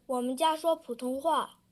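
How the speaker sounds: ordinary talk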